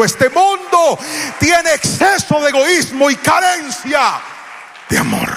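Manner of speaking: más agudo y fuerte, clamando lento; grito de predicador. Acted. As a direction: clama al público con fuerza. (grito del predicador a la iglesia)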